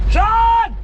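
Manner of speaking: high, loud yell of a name; shouting. Real, not acted. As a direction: yell across the garage. Calling out in panic.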